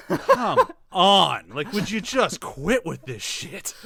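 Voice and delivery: loud, drawn-out exasperated plea. Acted. Host voices Killface's reaction to awful jokes, exasperated. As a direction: beg them to stop, slow and fed up. Acts out fed-up silent reaction.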